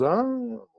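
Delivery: high, drawn-out "oh"; imitated poker tell. Poker player reacting to a bad card, imitated "oh". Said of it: "he does this, and he goes".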